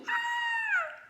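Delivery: very high shrill scream of fright; terrified therapist's high shriek. Acted. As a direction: shriek and flee. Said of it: "The therapist screamed".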